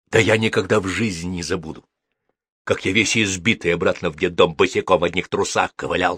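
plain reading voice

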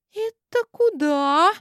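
high, slow and wary; suspicious. Acted. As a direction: ask it with narrowed eyes. Suspicious question.

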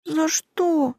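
put-on high, sniffly girl's voice; sniffling. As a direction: ask through sniffles. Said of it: "шмыгая носом". (всхлипывая, высоким голосом)

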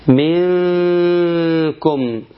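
slow, drawn-out ikhfa with long nasal hum on the N; slow tajweed recitation; Quranic recitation. Shown as a demonstration. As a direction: recite منكم stretching the hidden nun into a long ghunna. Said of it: "في كلمة منكم".

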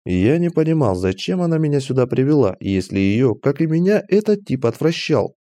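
plain reading voice

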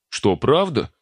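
sudden hopeful delight; overly delighted. A narrator's reading. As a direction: perk up a little too happily. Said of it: "слишком уж обрадовался Макар".

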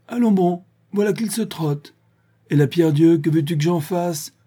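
high, loud taunting calls; jeering. A narrator's reading. Old druid mocks Vorsky as he flees, jeering. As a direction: jeer after someone running away. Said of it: "les quolibets du vieux druide".

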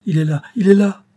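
high, loud exclamation; ringing cry. A narrator's reading. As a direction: cry it out resoundingly. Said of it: "s'écria d'une voix retentissante". (cri d'une voix retentissante)

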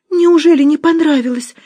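high, loud, almost sobbing; near tears. A narrator's reading. Musya pleads with Nora, high and tearful. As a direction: Musya about to cry over rejected food. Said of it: "чуть не зарыдала Муся".

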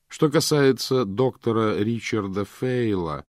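usual speaking voice

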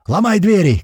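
high, loud command; shouting. A narrator's reading. Someone in the firefight ordering doors broken, loud shout. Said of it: "кричит кто-то".

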